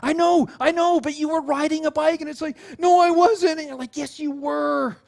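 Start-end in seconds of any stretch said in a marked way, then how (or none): 0.0-2.2 high, raised-voice reassurance; emphatic, animated encouragement; insistent parent. Retold or imitated. Parent insisting to upset child. 2.8-3.6 put-on high, loud kid denial; petulant little-kid voice; protesting child. Child contradicting parent. 4.0-5.0 high, slow, drawn-out insistence; stretched-out encouragement; insistent parent. Retold or imitated. Parent contradicting child tenderly.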